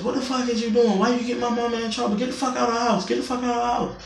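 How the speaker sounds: high, mocking imitation of the angry daughter; imitating the daughter. Narrator mimics the landlady's daughter yelling at her, high mocking voice. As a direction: squeak her lines up high like a mock. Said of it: "She get in my face".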